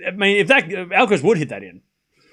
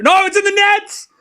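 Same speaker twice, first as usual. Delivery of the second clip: sudden high cry of disbelief as the ball hits the net; surprised outcry; 10 semitones higher, 3 dB louder than usual. Real, not acted. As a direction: yelp it out, caught off guard. Volley winner goes into net.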